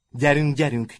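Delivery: loud urging call; loud come-on call. A narrator's reading. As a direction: hurry everyone along. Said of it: "kiáltott Martin".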